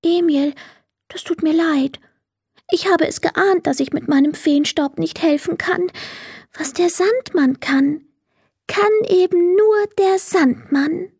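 high, mildly sad fairy apology. A narrator's reading. Fairy apologizes to Emil near tears, a touch of sadness. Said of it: "sagte traurig".

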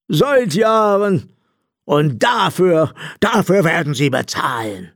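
high, drawn-out accusing robber voice; aggrieved, put-on high voice; vengeful blind robber. A narrator's reading. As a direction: threaten him slowly in a high character voice. Robber vows to make him pay.

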